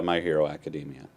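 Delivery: ordinary talk, nothing marked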